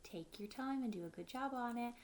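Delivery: usual speaking voice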